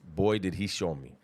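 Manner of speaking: normal talking voice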